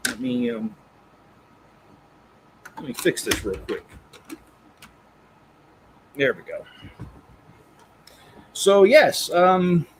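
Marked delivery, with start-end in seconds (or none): none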